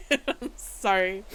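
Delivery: slow sorry through laughter; laughing apology. Real, not acted. Judge apologizing while laughing at the case, giggling. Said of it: "Why am I laughing about this?".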